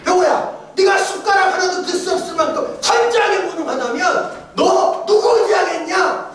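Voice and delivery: high, loud, and slowing to the end; loud high rhetorical challenge from God; God's challenging voice. Retold or imitated. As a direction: press the question home as God. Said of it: "하나님 바로 내 안에서 말씀하세요".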